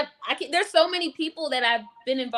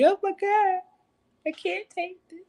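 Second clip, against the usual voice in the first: much higher mock-overwhelmed voice; imitating overwhelmed friend; 11 semitones higher than usual. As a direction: play her as overcome, lifted way up high. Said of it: "She's probably like".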